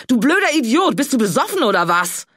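high, loud angry yell at the driver; furious shout. Acted. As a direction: scream it after the car. Yelling after the speeding car.

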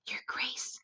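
very soft, voiceless whisper. A narrator's reading. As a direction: whisper it close to his ear. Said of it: "came up very close to the Duke and whispered".

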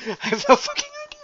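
laughing, high and fast. Real, not acted. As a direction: laugh the words out. Laughing take.

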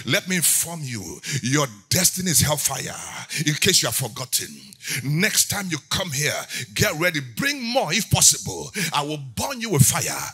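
loud, defiant warning to an enemy; defiant preaching. Acted. Preacher warns the enemy of hellfire, loud and defiant. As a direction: threaten the devil with force.

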